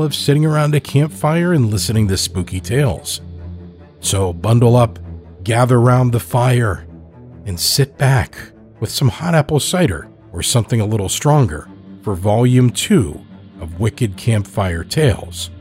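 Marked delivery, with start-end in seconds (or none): none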